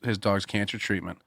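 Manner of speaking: normal talking voice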